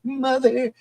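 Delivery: put-on high-pitched eerie child's call; creepy little kid. Little kid calling for mother to instill horror, high child voice. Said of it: "like, little kids like".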